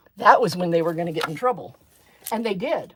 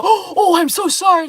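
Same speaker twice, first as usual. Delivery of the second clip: higher, louder mock apology; apologetic kid; 11 semitones higher, 8 dB louder than usual. Kid who swore, apologizing to the teacher in a higher voice. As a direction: play a flustered kid saying sorry. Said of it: "immediately go".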